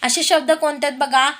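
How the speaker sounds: normal talking voice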